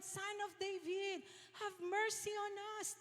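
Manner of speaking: high, thin mock shout held at normal volume. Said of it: "pero the more, talagang sabi nila".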